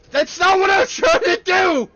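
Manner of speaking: loud, high frustrated yell; angry. Real, not acted. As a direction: shout in exasperation. Card game goes wrong.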